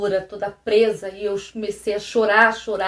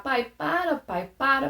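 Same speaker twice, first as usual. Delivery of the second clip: put-on higher, pleading child's voice, a touch of crying; mild retold sob-plea; 4 semitones higher than usual. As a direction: beg him to stop, half-retold. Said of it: "E eu comecei a chorar".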